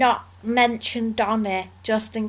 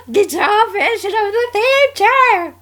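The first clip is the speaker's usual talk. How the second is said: higher, louder, laughing through it; laughing excitedly; 11 semitones higher, 7 dB louder than usual. Real, not acted. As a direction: giggle while gushing. Gushing about theme tune.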